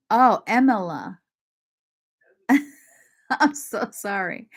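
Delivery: usual speaking voice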